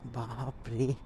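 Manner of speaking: ordinary talk, nothing marked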